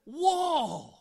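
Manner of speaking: high, loud, drawn-out exclamations; retold amazed realization. Preacher reacts to brother-in-law's solution, loudly amazed. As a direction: exclaim as if it suddenly became obvious. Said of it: "And it was like".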